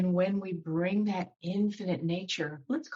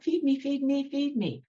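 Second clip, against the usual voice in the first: put-on baby bird begging; 7 semitones higher than usual. Teacher voicing hungry baby birds, higher chick-like begging. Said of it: "like a baby bird going".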